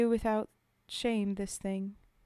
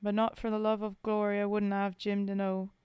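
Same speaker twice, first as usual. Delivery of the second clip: put-on poem read in a rough British soldier's accent; 5 dB louder than usual. Streamer reading Bill's Grave to chat, in a British soldier's accent. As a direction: tell it as a homesick Tommy at the grave.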